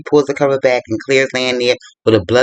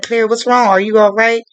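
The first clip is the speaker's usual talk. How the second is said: raised-pitch, concerned questions; retold concern, pitched well up; worried questioning; 8 semitones higher than usual. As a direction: act out an alarmed partner checking in. Husband's worried questions, acted out.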